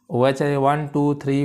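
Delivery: normal talking voice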